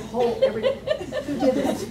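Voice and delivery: ordinary talk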